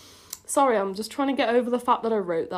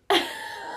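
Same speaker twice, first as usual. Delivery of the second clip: voiceless burst of laughter; breathy laugh. Real, not acted. Cracks up at own writing.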